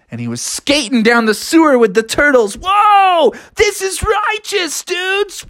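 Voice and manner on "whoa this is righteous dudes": high, slow dude cheer; exhilarated whoop. Acted. Froggy skates off with the turtles, thrilled. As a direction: holler it while zooming along.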